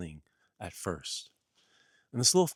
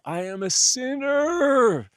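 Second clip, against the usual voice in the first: much higher, louder, stretched-out anguished cry of sinner; wailing confession; 12 dB louder, slower than usual. Acted. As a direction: wail it out in hammed-up despair. Jesus denies being Messiah.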